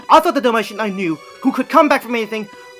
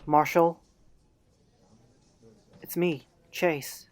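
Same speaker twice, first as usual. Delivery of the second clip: soft, low and tentative; hushed, careful check-in; gentle coaxing; 5 semitones lower, 9 dB quieter, much slower than usual. Acted. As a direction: speak softly to reach an unresponsive friend. Friend gently rousing unresponsive Marshall.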